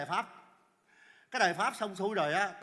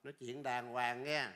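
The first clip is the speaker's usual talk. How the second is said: hạ thấp giọng, chậm rãi răn đe; trầm xuống, nói chậm và nhỏ hơn; nghiêm giọng cảnh cáo; 8 semitones lower, 5 dB quieter than usual. Acted. Nghiêm giọng răn người chạy xe. (dằn giọng cảnh cáo)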